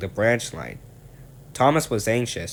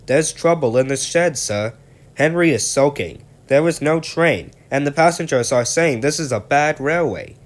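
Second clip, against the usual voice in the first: raised, louder anxious station master; station master reports trouble in the shed, worried and raised; 6 semitones higher, 7 dB louder than usual. Acted. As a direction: hurry in with bad news, fretting. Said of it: "came in looking worried".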